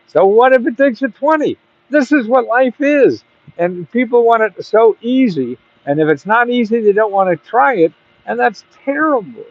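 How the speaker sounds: fired up, pleading; passionate rant. Real, not acted.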